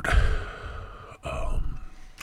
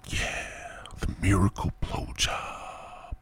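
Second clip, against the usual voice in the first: slow, softer, reverent whisper; 10 dB quieter, much slower than usual. Real, not acted. Host whispers in awe about the miracle. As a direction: savor it in a hushed voice.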